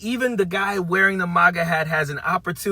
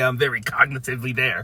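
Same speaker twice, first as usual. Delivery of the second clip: Donald Trump; 2 semitones lower, 5 dB quieter than usual. Retold or imitated. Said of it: "As Donald Trump would say".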